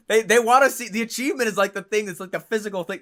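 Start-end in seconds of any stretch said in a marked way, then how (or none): none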